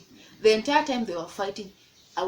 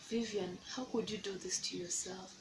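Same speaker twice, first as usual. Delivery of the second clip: much softer, scolding herself under her breath; muttered self-reproach; 10 dB quieter than usual. Retold or imitated. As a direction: ask yourself quietly in disbelief. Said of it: "I'm like".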